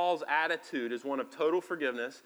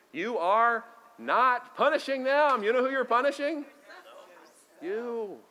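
higher, louder preaching; preaching at full force; 8 semitones higher, 5 dB louder than usual. Acted. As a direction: drive it home to the congregation. Preacher climax.

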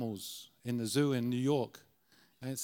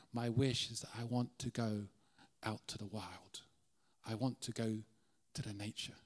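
zebra dreaming softly; 4 semitones lower, 6 dB quieter than usual. Acted.

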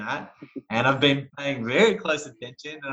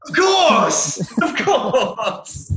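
high, loud, emphatic "of course"; emphatic exclamation; 10 semitones higher, 6 dB louder, much slower than usual. Real, not acted. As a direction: shout it out, laughing. Emphatic answer about Carole Baskin.

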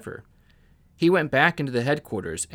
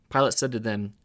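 ordinary delivery

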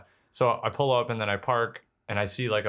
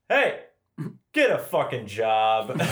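teasing yell; 2 semitones higher, much slower than usual. Retold or imitated. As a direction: heckle him slowly from the car.

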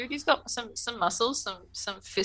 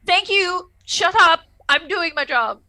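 higher and louder, a curt brush-off; irritated snap; 8 semitones higher, 7 dB louder, slower than usual. Retold or imitated. Picard snapping at Remek to shut up, irritated. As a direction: bite it off fast, fed up.

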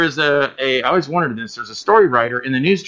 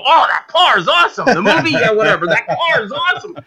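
high, gushing mock excitement; mock gushing fan; 9 semitones higher than usual. Fans brushing off his movie for the car, mock gushing. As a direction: gush over the car like a starstruck fan. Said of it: "they ignore my movie and go".

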